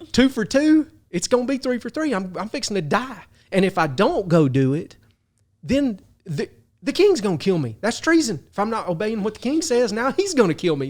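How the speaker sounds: high, hammed-up panic; freaking out. Captain fearing fire or the king, mock-panicked and high. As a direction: squeak out the doom like a doomed man, half-joking. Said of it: "freaking out".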